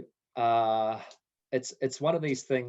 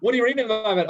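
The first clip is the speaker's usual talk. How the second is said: mock-eager friend, much higher and louder, through laughter; hammed-up imitation; 11 semitones higher, 9 dB louder, much faster than usual. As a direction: ham up the pushy mate, laughing.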